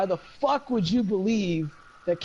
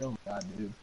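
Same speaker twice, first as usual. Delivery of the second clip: muttered aside; 10 semitones lower, 13 dB quieter, much faster than usual. Retold or imitated. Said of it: "I'm like".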